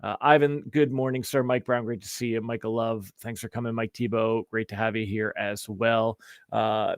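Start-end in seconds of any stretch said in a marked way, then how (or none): none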